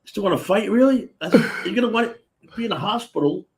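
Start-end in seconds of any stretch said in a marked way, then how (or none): 0.0-1.1 high, louder disbelieving question; can't believe you're still at it; incredulous. Retold or imitated. Bouncer's incredulous retort to drunk. 1.7-3.4 high-pitched pleading warning; retold exasperated reasoning. Bouncer reasoning with beaten drunk.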